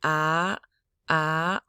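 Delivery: "Ah" sliding upward like a question; rising questioning tone. Shown as a demonstration. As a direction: lift the end of "ah" as if asking. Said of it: "This is a rising questioning tone".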